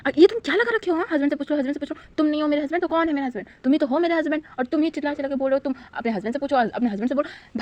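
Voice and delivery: flustered and fed up, spilling it all out fast; exasperated outburst. A narrator's reading. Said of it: "वांग जी का दिमाग सटक गया".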